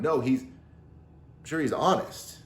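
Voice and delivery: normal talking voice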